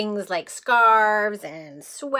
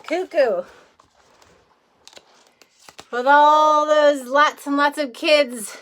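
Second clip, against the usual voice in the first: loud, lively and amused; amused, raised telling; animated; 4 semitones higher, 5 dB louder than usual. Real, not acted. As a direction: play up the craziness. Reader explains old woman's frustration.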